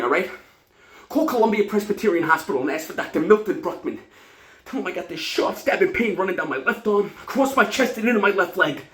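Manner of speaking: loud, high-pitched emergency orders; frantic panic. Acted. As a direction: fire off instructions as if dying. Acts panicked patient ordering calls for help.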